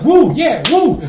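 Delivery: high loud whooping like a rowdy gang; mock cheering. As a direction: whoop it up like thugs celebrating. Gang cheering in retold movie.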